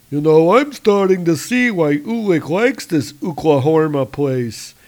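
a troll enjoying the raid; gleeful troll. Acted. Trolls mock the town.

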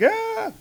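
high, sudden cry of terror; panicked scream. Pretend. Fleeing scientist screams at the bears, terrified high yelp. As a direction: yelp like a man chased by a bear.

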